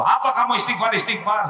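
high, loud sneering question; mocking boss. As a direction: jeer at the employee's prayer. Atasan menghardik karyawan. (atasan mengejek dengan suara keras)

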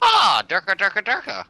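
high silly gibberish yell; silly shout. Pretend. Team America joke.

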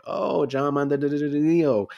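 mock recognition. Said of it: "And I'm like".

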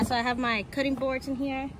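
usual speaking voice

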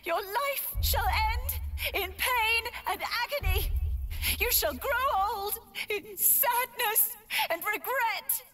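laying a curse. Acted. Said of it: "I curse you!".

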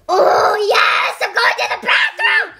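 gleeful shout. Pretend. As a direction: crow it in silly delight. Gleeful play in the game.

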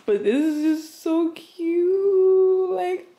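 high, slow, tearful gush over a cute gift; squealing with joy; overjoyed gushing. Real, not acted. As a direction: melt with delight, almost crying.

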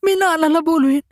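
high, frightened woman's threat; a cornered woman threatening to call her father; barely getting it out. Acted. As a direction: force the words out in fear. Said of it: "ती कसंबसं बोलली".

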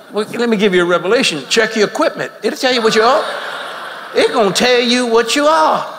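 high, loud, laughing preacher's cry; full-force preaching. Acted.

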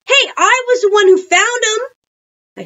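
put-on high, loud, drawn-out kid protest; indignant claim. A narrator's reading. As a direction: stake your claim over the others. Child squabbles over who gets books.

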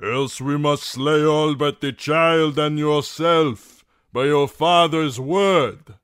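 ringing, measured shout; captain calling. A narrator's reading. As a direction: proclaim the threat across the water. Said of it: "the captain called".

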